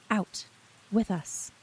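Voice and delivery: usual speaking voice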